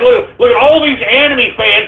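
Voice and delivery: ordinary talk, nothing marked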